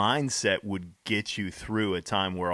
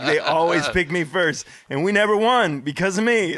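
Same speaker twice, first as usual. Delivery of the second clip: loud, higher mock complaint; exasperated retelling; 6 semitones higher, 7 dB louder than usual. Friend complaining about always being picked first, exasperated. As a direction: imitate a friend's exasperated protest, voice raised. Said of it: "he's like".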